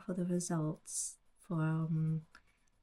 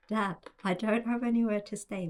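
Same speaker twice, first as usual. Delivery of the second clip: louder, higher, faster, stressing "anywhere"; exasperated; 4 semitones higher, 6 dB louder, faster than usual. Retold or imitated. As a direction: raise your voice, fed up with dad. Repeats it to dad, exasperated.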